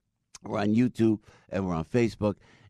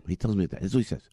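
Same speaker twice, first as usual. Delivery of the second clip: ordinary talk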